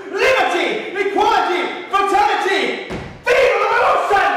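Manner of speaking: rally the people; loud triumphant revolutionary cry; rallying shout. Acted.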